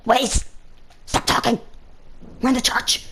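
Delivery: hissed whisper telling-off; hushed, cross reprimand; scolding teacher. Retold or imitated. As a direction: imitate a teacher whispering sternly. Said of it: "She says".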